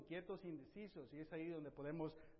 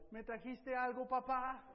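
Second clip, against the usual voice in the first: hungry son asking; 6 semitones higher, 5 dB louder than usual. Retold or imitated. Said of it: "me dice en español".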